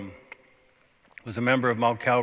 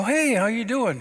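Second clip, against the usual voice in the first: high, cheery hello to a waking friend; bright greeting; 10 semitones higher, 3 dB louder than usual. Retold or imitated. Pastor greeting his buddy in hospice, bright and high. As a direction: lift the voice, greet him brightly.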